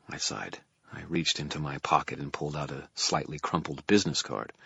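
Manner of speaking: usual speaking voice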